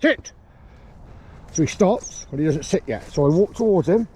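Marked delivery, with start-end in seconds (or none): 0.0-0.3 shouted command. Real, not acted. Trainer shouting sit to his distant dog. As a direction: stop the dog dead from afar.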